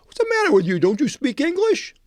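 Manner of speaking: mock disbelief that he can't follow; exasperated guard. Gate guard scoffs at the visitor who didn't understand, mock exasperation.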